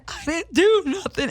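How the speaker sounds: high, drawn-out mock protest; mock crying. Retelling a woman pleading innocence to police, mock crying. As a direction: stretch it into a high fake wail. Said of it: "start crying and try to tell the police".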